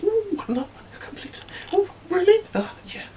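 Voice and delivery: very high squeaky mock gossip; catty gossip. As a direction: squeal and whisper snide remarks. Imitating other women gossiping.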